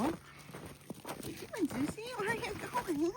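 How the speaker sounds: high soft pet talk; talking to the dog. Real, not acted. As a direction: coo at the dog. Talking to her dog Susie.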